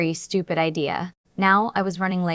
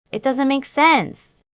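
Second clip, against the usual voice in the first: exclaimed; 6 semitones higher, 3 dB louder, slower than usual. A narrator's reading. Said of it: "I exclaimed".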